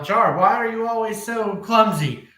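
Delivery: acted telling-off to cover up, pitched high; play-it-off yell. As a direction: call out in put-on exasperation. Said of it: "I try to play it off and yell".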